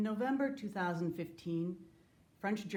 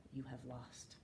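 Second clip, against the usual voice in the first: much softer, low final words; hushed verdict; 4 semitones lower, 13 dB quieter, slower than usual. Acted. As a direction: let the verdict drop to almost nothing. Final line to the killers.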